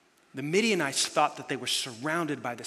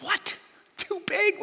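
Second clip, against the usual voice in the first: high, shrill-free yelp of alarm, much higher and louder; panicked disbelief; 5 dB louder, slower than usual. Retold or imitated. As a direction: squeak it out like a man told he'll lose. Gideon panics at God's plan.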